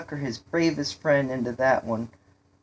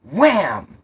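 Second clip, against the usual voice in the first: sudden impact; 4 semitones higher, 9 dB louder than usual. A narrator's reading. Narrator voices a sudden crash.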